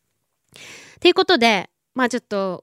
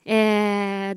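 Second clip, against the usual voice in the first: usual speaking voice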